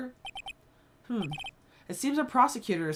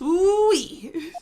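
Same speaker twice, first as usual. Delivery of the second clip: excited whoop; 7 semitones higher, 4 dB louder than usual. Acted. Third whoop, softer.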